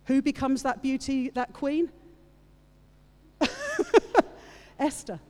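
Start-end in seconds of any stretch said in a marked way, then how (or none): 3.4-4.3 high bright burst of laughter. Real, not acted. Preacher laughing before naming the new queen, loud laugh. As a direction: laugh loudly before the reveal.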